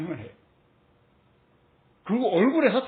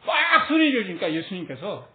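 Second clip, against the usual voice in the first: usual speaking voice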